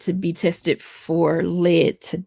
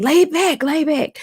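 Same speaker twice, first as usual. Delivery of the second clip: doctor's urgent order; 9 semitones higher, slower than usual. Retold or imitated. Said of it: "my doctor was like".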